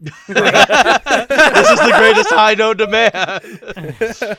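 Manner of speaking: loud, slow, high laughing rapture; ecstatic. Real, not acted. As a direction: gush it out laughing. Laughing exaggerated elation.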